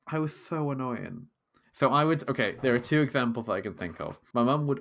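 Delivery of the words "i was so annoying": usual speaking voice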